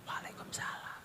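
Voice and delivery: a barely audible whispered reply. Shown as a demonstration. Woman shows she answered too softly, whispering. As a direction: breathe the greeting out in a whisper. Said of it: "Jawab tapi pelan".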